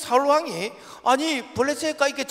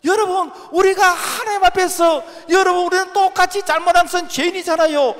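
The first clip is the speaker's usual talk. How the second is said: higher, louder full-force exhortation; preaching shout; 7 semitones higher, 5 dB louder than usual. Real, not acted. Preacher shouting at the congregation about sin, fervent. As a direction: cry out to the congregation in earnest.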